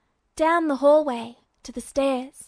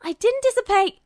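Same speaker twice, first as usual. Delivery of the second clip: indignant protest; 10 semitones higher, 5 dB louder than usual. Acted.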